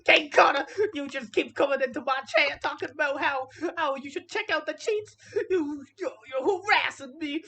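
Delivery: loud, high, hammed-up blubbering; whiny mock crying. Commentator making fun of a streamer's breakdown, whiny fake crying. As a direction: whine and sob like an overgrown child. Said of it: "talking in his whiny voice".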